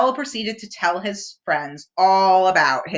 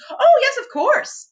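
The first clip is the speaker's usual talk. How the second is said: Owl voice; 5 dB louder than usual. A narrator's reading.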